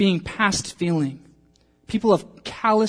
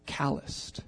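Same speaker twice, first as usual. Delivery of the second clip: whispered word; 4 semitones lower, 13 dB quieter than usual. Real, not acted. Preacher whispers calloused to the congregation. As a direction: drop to a breathy whisper on calloused.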